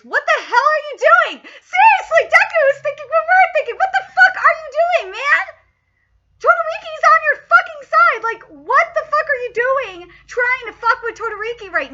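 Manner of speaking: high, loud, laughing rant; exasperated yelling. Real, not acted. Fan yelling at the hot-headed student, exasperated shout. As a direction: shout at the page in disbelief.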